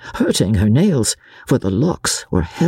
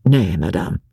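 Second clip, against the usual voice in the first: lower, cold refusal; coldly; 6 semitones lower than usual. A narrator's reading. Husband coldly refusing wife's thanks. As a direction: shut her thanks down. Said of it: "he said coldly".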